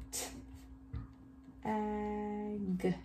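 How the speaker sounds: "T-, ag" split, with a long held "ag"; sounded out. Shown as a demonstration. Teacher helping children write the word, sounded out. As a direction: sound out T, pause, then stretch ag. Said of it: "What's our first word?".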